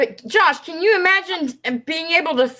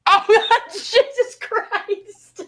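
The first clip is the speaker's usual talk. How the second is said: laughing; 7 semitones higher, much slower than usual. Real, not acted. Shocked at the butt plug admission.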